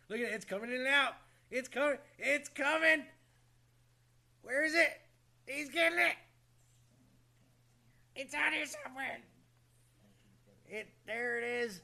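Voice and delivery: hyped up, waiting for the show; excited. Real, not acted.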